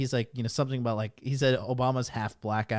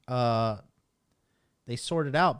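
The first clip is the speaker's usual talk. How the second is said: usual speaking voice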